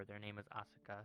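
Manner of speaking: ordinary delivery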